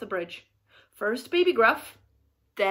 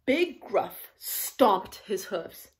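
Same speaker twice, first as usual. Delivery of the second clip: usual speaking voice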